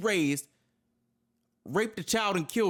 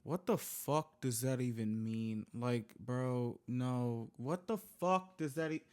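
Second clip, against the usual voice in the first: stunned mutter; 9 semitones lower, 8 dB quieter, slower than usual. Real, not acted. Stunned by the father's quote.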